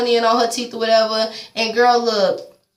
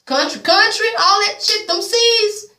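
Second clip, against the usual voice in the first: high-pitched, incredulous exclaiming; 12 semitones higher, slower than usual. Real, not acted. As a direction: pitch it way up in disbelief. Incredulous about long-distance love.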